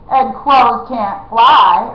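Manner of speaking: loud, slow mocking blast; erupting. A narrator's reading. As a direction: burst out in scorn. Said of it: "erupted the big outlaw".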